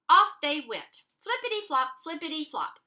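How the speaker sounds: bouncy sing-song flippity-flop; a bouncy rhythm for children; playful read-aloud. A narrator's reading. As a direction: bounce the words like footsteps. Playful sound words read aloud.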